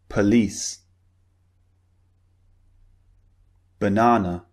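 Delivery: each word alone, careful, with long pauses between; listen-and-repeat word list. Shown as a demonstration. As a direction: model each word clearly, unstressed syllables as a short "uh". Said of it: "So listen and repeat".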